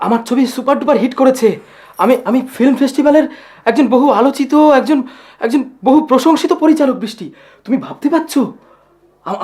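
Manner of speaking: high, loud, stammering elation; overjoyed excitement. Acted. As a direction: burst out with the triumphant news. Breaks great news of his success.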